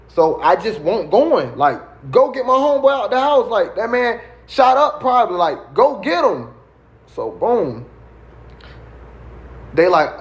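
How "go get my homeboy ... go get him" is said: high-pitched, urgent insisting; insistent retold plea. Patient retells urging the medics to rescue his friend, high insistent plea. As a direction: push the demand, pitched well up.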